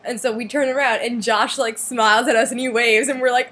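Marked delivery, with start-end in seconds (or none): none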